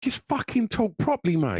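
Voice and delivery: high exasperated outburst; mock frustrated plea; fed up with youth slang, a high exasperated cry. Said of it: "I just wanted to go".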